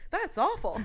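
high, loud that's awful; Martin Short reaction. Retold or imitated. Martin Short reacting to the story, high mock-shocked. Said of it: "And he goes".

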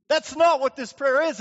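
ordinary talk

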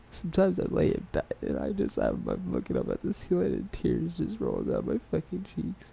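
soft, halting fake breakdown; mock tearful confession. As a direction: play at being near tears, voice hushed.

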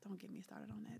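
whispered aside; hushed, breathy under-the-breath remark. Real, not acted. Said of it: "whispered aside".

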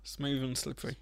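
soft suggestive whisper. Pretend. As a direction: breathe it out teasingly. Suggestive answer about hair.

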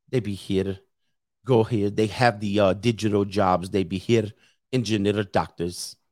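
put-on low, half-hearted Indian accent; attempted Indian accent. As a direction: try an Indian accent and not quite land it. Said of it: "I can't do my Indian accent today".

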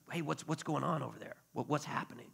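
lightly acted puzzled bystander question; acted quiet asking. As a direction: ask quietly, as if to someone nearby. Blind man asking passers-by, acted.

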